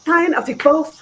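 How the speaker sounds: mock teenage huff; exasperated teen giving in. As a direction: throw up your hands, fine. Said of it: "I said".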